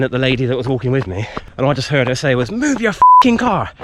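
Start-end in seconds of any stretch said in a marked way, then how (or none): 2.5-3.7 high, loud, slow angry yell; mock her furious shout; retold shout. Retelling the woman in the car yelling at them, loud angry shout. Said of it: "she was shouting".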